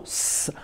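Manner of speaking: S said as a long hiss, sss; letter sound. Shown as a demonstration. Teacher shows pupil the end of the name, sounding S as a hiss. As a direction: hold S as a pure consonant, no vowel. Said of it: "tu entends le son S".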